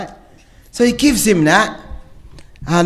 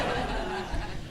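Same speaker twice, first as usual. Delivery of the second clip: quiet breathy laugh; faint trailing laugh; soft chuckle; 15 dB quieter than usual. Real, not acted. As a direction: let the laugh fade out.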